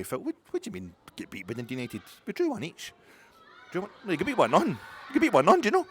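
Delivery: high, loud outraged protest; indignant protest. Acted. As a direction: protest the result, rising in outrage.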